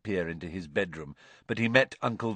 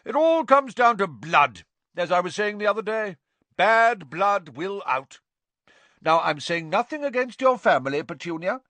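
very high, loud lecturing; loud, self-important aunt lecturing; pompous Aunt Marge; 11 semitones higher, 6 dB louder than usual. A narrator's reading. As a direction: pronounce it like a breeder's law. Marge on blood.

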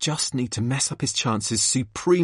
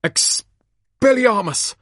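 spell shout; 10 semitones higher, 5 dB louder, much slower than usual. Acted. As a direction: shout the spell, high and slow. Casting a spell.

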